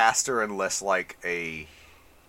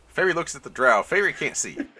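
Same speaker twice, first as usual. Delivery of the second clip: ordinary talk, nothing marked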